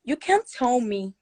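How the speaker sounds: usual speaking voice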